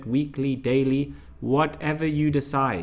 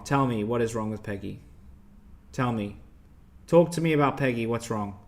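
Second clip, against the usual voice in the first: ordinary delivery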